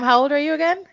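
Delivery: ordinary talk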